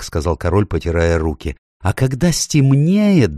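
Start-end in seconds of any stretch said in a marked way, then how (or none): 1.8-3.4 high, drawn-out faun voice; eager faun. A narrator's reading. Faun adding the next step of escape, eager. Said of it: "добавил Фавн".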